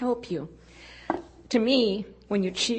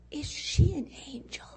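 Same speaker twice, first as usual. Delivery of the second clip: put-on hushed, awed child whisper; whispered question; 9 semitones higher than usual. Little girl whispering to her mother about the teacher. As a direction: breathe it like a wondering little girl.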